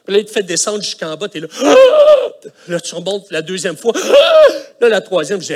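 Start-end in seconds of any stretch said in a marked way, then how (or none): 1.5-2.6 high, sharp gasp of a falling ride; loud gasp. Retold or imitated. Pastor miming the Disney drop ride, loud gasp. 4.0-4.8 high, sharp gasp of a falling ride; loud gasp. Retold or imitated. Pastor miming the Disney drop ride, loud gasp.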